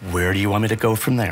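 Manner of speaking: very low, controlled question; forced patience. Real, not acted. Dad asking child for next direction, forced patience. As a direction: keep it low and tightly controlled.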